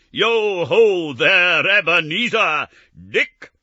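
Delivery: loud, high, slow jolly calling; a fat jovial master calling his apprentices. Acted. Fezziwig calling to his apprentices, rich and jovial. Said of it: "comfortable, oily, rich, fat, jovial voice".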